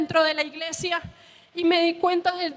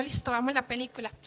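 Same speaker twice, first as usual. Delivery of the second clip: quick, quiet and reluctant; half-hearted mock enthusiasm; grudging agreement; 4 semitones lower, 7 dB quieter, faster than usual. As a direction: mumble it like you'd rather not. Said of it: "lo hice de mala gana".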